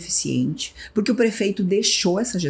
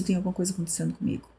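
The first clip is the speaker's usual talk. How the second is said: softer, fast, to herself; hushed admission; quiet realization; 8 dB quieter, much faster than usual. Retold or imitated. To herself after freezing, softer and faster.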